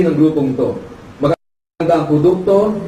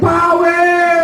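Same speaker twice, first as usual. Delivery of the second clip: excited shout; 12 semitones higher than usual. Retold or imitated. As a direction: shout it with joy.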